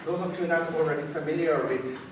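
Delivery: normal talking voice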